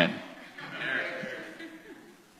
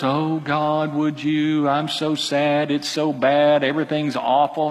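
slow, droning mock lament; mock milquetoast prayer; slower than usual. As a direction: imitate a weak, mumbly prayer. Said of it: "these mumbly, kind of weak, milquetoast prayers".

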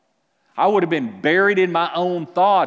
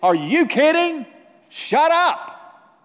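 high and loud, snapping the words off; mock angry brush-off; 12 semitones higher, 6 dB louder, slower than usual. Preacher imagining Jesus brushing off the thief, high and loud. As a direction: throw it back at him with contempt. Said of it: "He could have said".

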